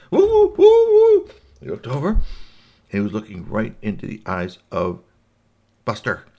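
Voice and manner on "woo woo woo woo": loud, very high "woo" yelps; startled alien hoot. Acted. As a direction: hoot in alarm like a touched creature. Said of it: "touched his nose mouth".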